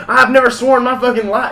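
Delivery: high, loud indignant rant; yelling. Retold or imitated. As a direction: yell it as the aggrieved gunman. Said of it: "He's, like, yelling".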